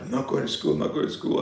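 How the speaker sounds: breathless, voiceless high sobbing words; half-whispered mock sob; imitating a crying kid. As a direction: mimic a child crying through the words. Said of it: "I'm just crying".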